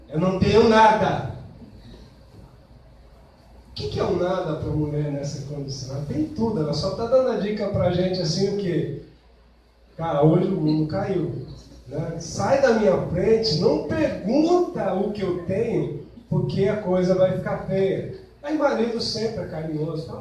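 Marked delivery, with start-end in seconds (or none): 0.1-1.3 curt. Acted. Sulking wife's reply. 10.0-18.2 warning. Acted. Wife warning husband off.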